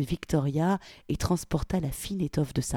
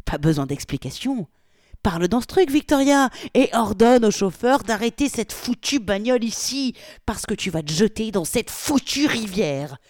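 higher, loud furious commands; angry orders; 6 semitones higher, 6 dB louder than usual. Acted. Polly angrily orders Victoria to stop the car, loud. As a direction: shout the orders at her.

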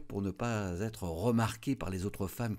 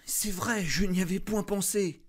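much higher and louder with delight; cry of joy; 13 semitones higher, 6 dB louder than usual. A narrator's reading. Wife answers her husband with a cry of joy. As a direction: burst out with sudden happiness. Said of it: "Elle poussa un cri de joie".

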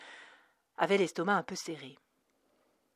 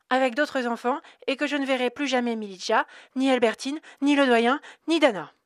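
put-on little girl's voice, higher and louder; a child rattling it off, building as she goes; 7 semitones higher, 8 dB louder than usual. A narrator's reading. Little Cerise telling the educator, higher and louder.

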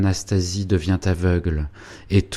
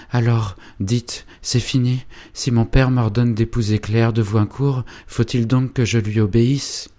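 higher, anguished, first words slowed; despairing plea; 5 semitones higher, 5 dB louder than usual. Acted. Félicien asking Angélique if he must obey, anguished. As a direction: beg her for the answer.

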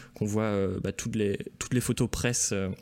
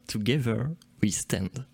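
slow, deliberate English accent; careful, drawn-out stab at an English accent; attempted English accent; slower than usual. Shown as a demonstration. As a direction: spell out the English accent word by word, very slowly. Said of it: "j'essaie de le faire avec l'accent anglais".

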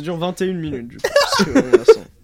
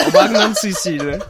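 laughing; 4 semitones higher, 9 dB louder than usual. Real, not acted. Repeats the joke name, cracking up.

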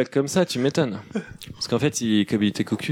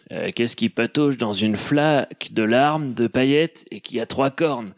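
slow joke caller; 4 semitones lower, much slower than usual. Acted.

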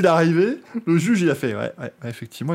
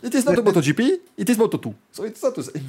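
hammed-up Italian English, pitched high and slow; mock the champion's struggling English, Italian words slipping in; Italian accent English; 8 semitones higher, 4 dB louder than usual. Valentino Rossi answering reporters in heavy Italian-accented English. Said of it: "qui parle anglais tous les weeks-ends".

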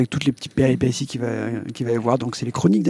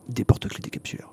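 whisper; 3 semitones lower, 7 dB quieter than usual. Acted. Punchline whispered as a treasured secret.

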